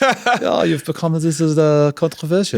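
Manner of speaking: high-pitched, badly faked German accent. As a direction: put on a hammy German accent. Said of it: "if you had a German accent".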